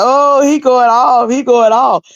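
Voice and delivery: high, slow, loud gossiping friends; amazed callers, hammed up; excited gossip. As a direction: squeal it like callers spreading news. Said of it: "they was like".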